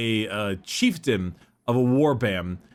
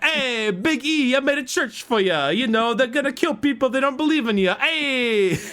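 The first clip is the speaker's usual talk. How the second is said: Lorgar as Italian Fonz; 11 semitones higher than usual. Retold or imitated. Lorgar talking to the Emperor in a high mock Italian voice. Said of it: "Lorgar is like".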